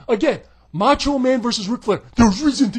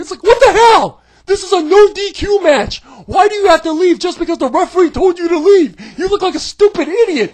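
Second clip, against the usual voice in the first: loud, higher, shouting in disbelief; exasperated rant; 6 semitones higher, 7 dB louder than usual. Real, not acted. Reviewer ranting at the silly finish, loud shout. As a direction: yell it like you can't believe it.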